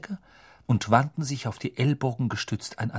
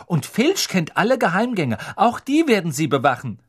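higher, louder know-it-all explaining; lecturing Hermine; 7 semitones higher, 3 dB louder than usual. Acted. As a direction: set them straight point by point.

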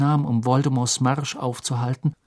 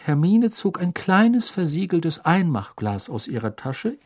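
usual speaking voice

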